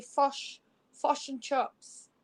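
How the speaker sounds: "fush" with a short rounded u-sound instead of the i in fish; imitating the New Zealand "fush and chips" vowel; Kiwi fish. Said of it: "they apparently say".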